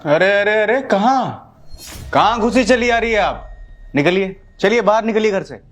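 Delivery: high, indignant outburst; angry challenge. Acted. Husband orders mother-in-law out.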